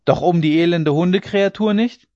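incredulous shouted question; scornful shout. A narrator's reading. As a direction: yell back in disbelief and contempt. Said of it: "schrie eine rauhe Stimme von unten".

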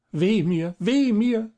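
a fairy crying out in distress, rising; wailing lament. A narrator's reading. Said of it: "rief die Fee". (laut klagend, hoch aufschreiend)

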